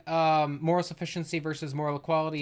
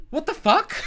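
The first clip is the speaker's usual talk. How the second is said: high, laughing what the fuck; incredulous laughing outburst; laughing disbelief; 11 semitones higher than usual. Real, not acted.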